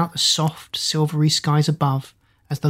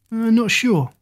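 unsure shrug, pitched up; shrugging; 6 semitones higher than usual. A narrator's reading. Doll prince asked how he became a doll, puzzled shrug. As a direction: sound clueless, shrugging it off. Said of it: "he shrugged".

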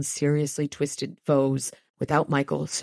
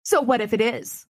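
raised, ringing challenge; defiant demand; 6 semitones higher, 4 dB louder than usual. Acted. Molly defying her mother over the wizard. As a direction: throw it back at her mother. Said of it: "she demanded".

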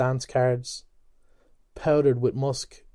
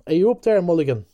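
higher, louder call up the tower; calling loudly; 6 semitones higher, 7 dB louder, much faster than usual. Acted. A voice inside the tower calling up to Mulligan, loud. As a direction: shout up from below. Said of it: "called loudly".

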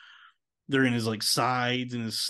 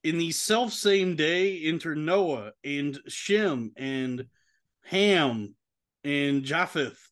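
higher, measured Bible-reading voice; scripture reading; 4 semitones higher, slower than usual. Acted. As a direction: read the verse aloud like a lector. Reads Genesis verses aloud.

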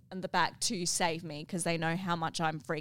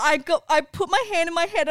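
ordinary talk

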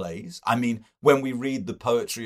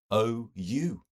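plain reading voice

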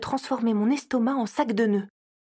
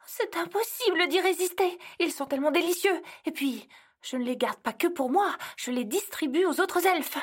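high pleading justification, a bit rushed; guilty excuses; 6 semitones higher than usual. Acted. Elf excuses her cookie theft to Fouettard, high and pleading. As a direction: make excuses with a guilty grin.

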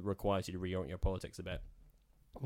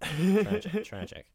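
mock tragic lament; 11 semitones higher, 7 dB louder, slower than usual.

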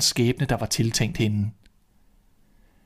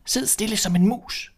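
put-on old woman voice; 10 semitones higher than usual. Old woman hides the girl.

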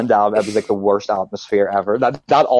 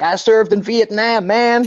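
mock veteran drawl; 10 semitones higher, slower than usual. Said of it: "one of those guys that's like".

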